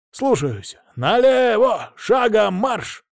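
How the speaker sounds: high, slow parade-ground shout; stiff barking toy soldier. Acted. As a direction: bark the drill commands. Sergeant barks drill commands. (отрывистые строевые команды)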